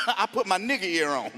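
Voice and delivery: ordinary talk, nothing marked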